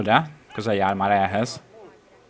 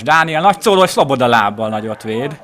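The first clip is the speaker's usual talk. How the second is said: higher, louder rush of play-by-play; excited commentary; 4 semitones higher, 7 dB louder than usual. Real, not acted. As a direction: call the big save with energy. Solo run and big save. (izgatott közvetítés)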